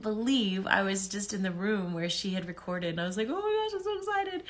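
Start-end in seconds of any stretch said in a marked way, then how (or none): 3.3-4.4 excited squeal. Retold or imitated. Recalling first day recording.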